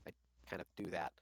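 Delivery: gentle, low consoling voice; soft comforting. Acted. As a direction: soothe him softly, like a friend. Comforting a friend in character.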